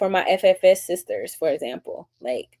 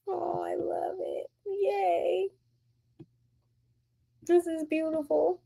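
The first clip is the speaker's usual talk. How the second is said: tender delight; 11 semitones higher, 9 dB quieter, slower than usual. Real, not acted. Moved by chat comments.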